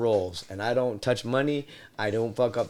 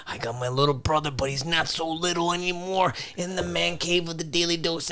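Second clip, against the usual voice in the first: wrestling promo; 4 semitones higher than usual. Pretend. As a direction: cut a promo like a wrestler in the ring. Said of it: "cut a promo live".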